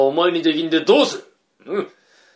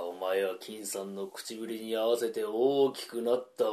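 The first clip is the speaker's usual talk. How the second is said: normal talking voice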